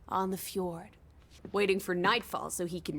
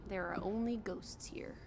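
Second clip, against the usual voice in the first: whispered, ominous; soft unvoiced spooky whisper; eerie whisper; 3 semitones lower, 10 dB quieter than usual. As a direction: hush it like a ghost story. Eerie remark in empty church.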